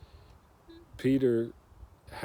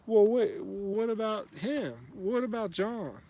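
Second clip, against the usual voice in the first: high-pitched mock protest, words drawn out; 10 semitones higher, 4 dB louder than usual. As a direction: whine it like an objecting disciple. Retelling Peter's objection to Jesus.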